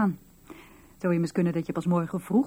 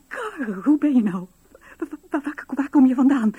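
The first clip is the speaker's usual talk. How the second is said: higher and louder, tripping over words; stammering joy; 7 semitones higher, 6 dB louder than usual. Acted. Wife questions returned husband with breathless, stammering joy. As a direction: stumble over the words in disbelief.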